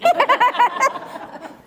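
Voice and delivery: high bright retold laugh; hammed-up chuckle for her; imitated laugh. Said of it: "And she laughed".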